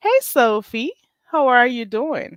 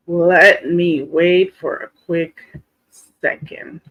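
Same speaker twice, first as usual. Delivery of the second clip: slow drawn-out; 3 semitones lower, slower than usual. Shown as a demonstration.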